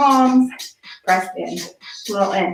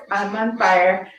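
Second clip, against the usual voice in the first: ordinary talk, nothing marked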